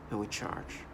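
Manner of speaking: low, hushed, mock threat; menacing imitation. Mocking his tormentors who try to sound menacing. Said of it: "they try to sound, as menacing".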